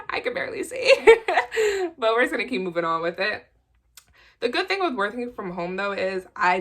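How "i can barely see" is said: laughing. Real, not acted. Can't see without glasses.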